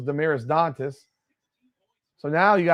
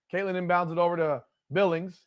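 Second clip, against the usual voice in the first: ordinary talk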